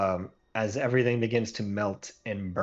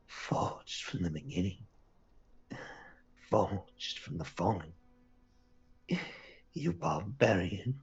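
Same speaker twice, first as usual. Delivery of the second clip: hushed, whispery vow through held-back tears; slow, broken whisper, swearing revenge; almost weeping; 6 dB quieter, slower than usual. Acted. Defeated villain vows revenge on the barbarians, near tears. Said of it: "she just sort of almost weeps for a moment".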